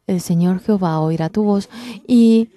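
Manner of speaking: ordinary talk, nothing marked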